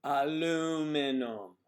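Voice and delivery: "Aluminum" slow and loud, long LOO; exaggerated American "Aluminum"; drawn-out American pronunciation. Shown as a demonstration. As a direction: stretch "Aluminum" out loudly as a-LOOO-mi-num. Exaggerated demo of the disputed word.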